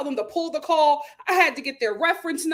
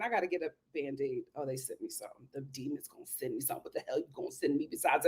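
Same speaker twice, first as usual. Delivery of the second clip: low, much softer, fast grumble; muttered aside; 10 semitones lower, 12 dB quieter, faster than usual. Real, not acted. Grumbling to herself about the company's message, low and soft. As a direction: mutter it to yourself.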